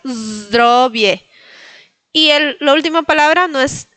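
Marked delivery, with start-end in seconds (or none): 0.1-1.2 Zdrowie opening on a long bee buzz; buzz, then DRO-vie in Zdrowie. Shown as a demonstration. Teacher reminding students of the bee, buzzed z. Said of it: "ese sonido como de una abeja".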